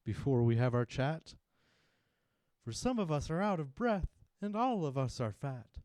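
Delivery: high, loud little oyster cry; squeaky high oyster protest. Acted. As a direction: call out in alarm as small oysters. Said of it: "the oysters cried".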